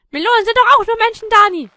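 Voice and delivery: high, loud pleading; indignant objector. Pretend. Objector pleading with Dani for melons, indignant.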